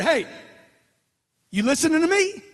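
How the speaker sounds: demonstrated yell at a listener; attention-getting shout. Shown as a demonstration. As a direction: call out to seize their attention. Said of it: "If you gotta scream to get it".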